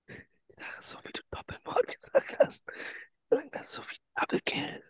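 hushed whisper. Real, not acted. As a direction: whisper so no one wakes up.

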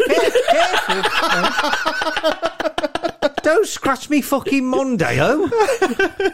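high, loud, slow mock shout while laughing; angry neighbour. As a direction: yell like an annoyed car owner. Angry neighbour at floating dreamer.

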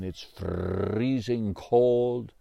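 "freezing" with a slow rolled R. Acted. Lumberjack telling Joey about winter, rolling R in "freezing". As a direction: roll the R in "freezing" slowly. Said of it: "you roll your R's".

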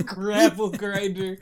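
slow words squeezed out through laughter; laughing. Real, not acted. Repeating the silly insult to a friend, laughing hard. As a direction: barely get it out while cracking up.

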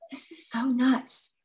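high, hushed-down disbelief; astonished aside. Retold or imitated. Retelling her reaction to a stranger bawling, astonished.

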